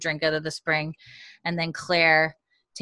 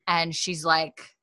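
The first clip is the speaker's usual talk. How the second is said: ordinary delivery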